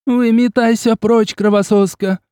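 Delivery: high, loud, hysterical yell; hysterical shout. A narrator's reading. Agnes hurling fire at the vampire, hysterical. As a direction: scream her out of the house. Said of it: "истерично закричала Агнес".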